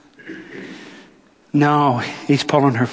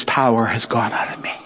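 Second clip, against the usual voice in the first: hushed whisper; 7 semitones lower than usual. Acted. Jesus speaking softly.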